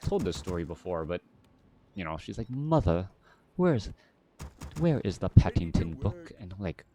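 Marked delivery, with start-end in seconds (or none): 2.5-6.3 daughter's prim British accent, imitated. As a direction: put on a proper British child's accent. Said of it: "has some sort of British accent".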